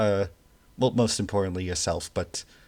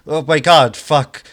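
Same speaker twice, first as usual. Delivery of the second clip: loud, higher-pitched cry of alarm; panicked yelling; 7 semitones higher, 12 dB louder than usual. Acted. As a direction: yell out like a construction crew watching it collapse. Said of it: "yelling from the construction team".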